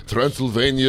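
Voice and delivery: put-on comic Transylvanian vampire accent; Dracula accent. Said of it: "What's with your stupid accent?".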